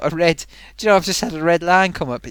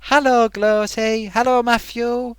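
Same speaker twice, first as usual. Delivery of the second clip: slow, higher voiced greeting as Matthew; a light impression of a friend saying hello; lightly voiced friend's greeting; imitating Matthew; 7 semitones higher than usual. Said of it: "he's saying".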